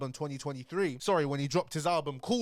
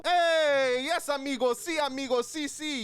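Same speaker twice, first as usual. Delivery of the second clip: excited Mexican fan; 10 semitones higher, 4 dB louder, slower than usual. Pretend. Imagined Mexican fans cheering.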